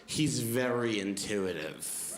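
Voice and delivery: slow, pointed, cutting delivery; mother turning openly hostile, slow and pointed; openly aggressive. Retold or imitated. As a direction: lay it down slowly and coldly. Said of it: "drops the passive part of passive aggressive".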